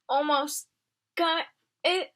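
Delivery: strained mutter. Acted. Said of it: "Bongo muttered between her clenched beak".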